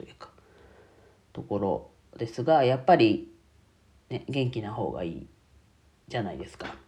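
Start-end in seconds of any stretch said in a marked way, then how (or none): none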